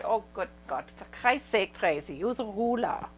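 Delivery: imitating the boss's strong German accent; exasperated German boss, lightly accented. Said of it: "strong German accent".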